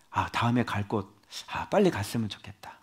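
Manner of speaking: soft, sighing private wish; wistful hushed thought; quiet inner longing. Retold or imitated. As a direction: murmur it as if to yourself, trailing into a whisper. Voicing his own inner wish.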